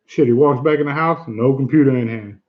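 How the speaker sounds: ordinary delivery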